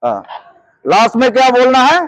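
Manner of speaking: usual speaking voice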